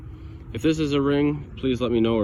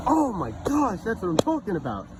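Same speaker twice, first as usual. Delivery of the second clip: high-pitched jubilant outburst; soaring, triumphant excitement; real elation; 8 semitones higher than usual. Real, not acted. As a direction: cry out high, thrilled by the find. Celebrating the rare coin find.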